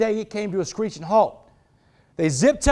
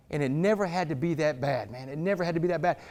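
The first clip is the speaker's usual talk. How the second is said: softer, lower, reflective aside; quiet regret; 4 semitones lower, 7 dB quieter than usual. Real, not acted. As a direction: drop the volume and let regret in. Regretful aside amid forceful speech.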